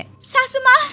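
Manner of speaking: high shouting call to mother-in-law. Acted. Bride shouting for mother-in-law, high and loud. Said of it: "Vibha चिल्लाती हुई".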